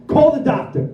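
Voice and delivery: high, loud retold outcry; urgent demand. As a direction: call out urgently, acting out alarm. Retold panic at losing the baby.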